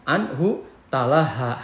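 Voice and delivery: "Anhu" with a clear, unhummed n; idhar recitation. Shown as a demonstration. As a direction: sound the n in "Anhu" plainly, then chant on. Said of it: "dibaca jelas".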